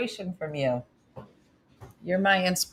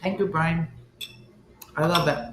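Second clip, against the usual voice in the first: talking around ice cubes; ice in mouth; 2 semitones lower, 5 dB quieter than usual. Real, not acted. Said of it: "I have ice in my mouth".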